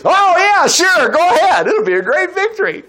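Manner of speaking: high-pitched, breezy fake agreement; hammed-up "sure, whatever you say"; mock agreement. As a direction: humor them with exaggerated, high-pitched enthusiasm. [name] parrots the false prophets.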